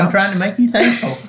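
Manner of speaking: chuckling through the words; laughing while talking. Real, not acted. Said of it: "I'm trying not to laugh at you".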